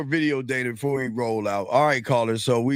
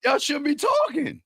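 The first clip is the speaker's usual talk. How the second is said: mocking echo; 12 semitones higher than usual. Host echoing the co-host mockingly.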